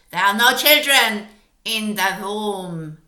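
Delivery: loud strange witch voice, still booming; Grand High Witch, loud. Acted. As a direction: bark it as a firm denial to the crowd. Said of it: "shouted the Grand High Witch".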